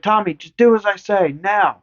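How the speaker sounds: put-on high, firm babysitter command; stern order. Babysitter ordering Tommy upstairs, stern. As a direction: lay down the law to Tommy. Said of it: "She's like".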